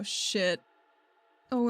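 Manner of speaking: ordinary talk, nothing marked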